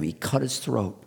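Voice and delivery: usual speaking voice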